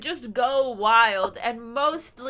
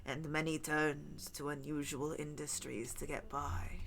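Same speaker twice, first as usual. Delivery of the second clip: lower, softer storyteller read; story narration; 7 semitones lower, 6 dB quieter than usual. Acted. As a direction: read it like a campfire tale. Reads a spooky folklore segment.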